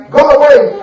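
loud, sharp shout to drive them off; shooing shout; angry go away. Acted. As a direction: bark at the beggars to leave. Locals chase the beggars off.

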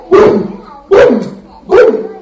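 high breathy boom boom boom; three mock crash sounds; smashing booms. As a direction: smash the idols with each boom. Smashing idols sound effect.

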